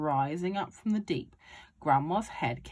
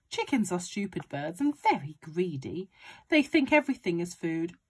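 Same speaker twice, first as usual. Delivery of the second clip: usual speaking voice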